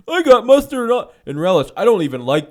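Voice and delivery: high, loud whining complaint; mock a petty whiner; whiny complainer. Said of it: "quoting complaining hot dog eater".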